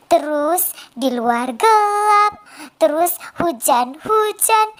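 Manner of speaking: usual speaking voice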